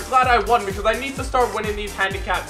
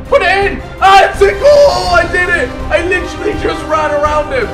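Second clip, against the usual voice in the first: high, loud goal celebration; excited shouting; 8 semitones higher, 8 dB louder than usual. Real, not acted. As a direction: yell with pure joy at scoring.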